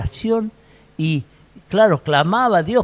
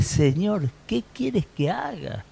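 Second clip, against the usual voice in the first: soft, higher-pitched acted plea to the Lord; súplica temerosa; 3 semitones higher, 7 dB quieter, slower than usual. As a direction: ask it humbly, hushed and a little timid. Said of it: "él temblando y temeroso dijo". (súplica suave y aguda, algo temerosa)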